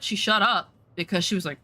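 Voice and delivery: normal talking voice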